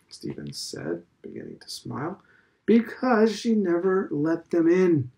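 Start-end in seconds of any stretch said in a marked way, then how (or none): 2.6-5.1 slow, weighty punchline; solemn. A narrator's reading. Old headmaster answering Stephen's question, slow and solemn. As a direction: deliver it gravely and slowly. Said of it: "Mister Deasy said solemnly".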